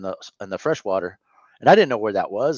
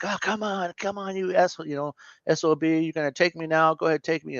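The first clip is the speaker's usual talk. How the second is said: daring God to take him, pitched up high; mock cussing at God; 6 semitones higher than usual. A man raging at God in the storm, high strained defiance. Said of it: "I was just cussing".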